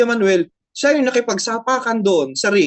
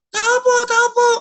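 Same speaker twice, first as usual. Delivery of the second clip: much higher, squawky parrot voice; parrot mimicking. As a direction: echo the caller like a talking parrot, pitched way up. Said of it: "Magre-reply naman yung parrot".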